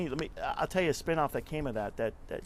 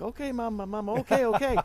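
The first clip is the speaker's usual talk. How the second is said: high, rising voice of a harried son; high-pitched, louder mock pleading; placating son; 10 semitones higher, 6 dB louder than usual. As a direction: imitate a son giving in to his boisterous mama. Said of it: "And he's like".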